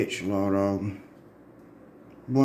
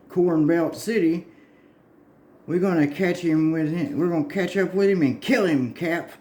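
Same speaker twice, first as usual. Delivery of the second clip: higher, eager comic-book hero voice; higher, bright-eyed cartoon hero urging the chase; heroic sidekick; 6 semitones higher than usual. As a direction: play the gung-ho sidekick chasing the villain.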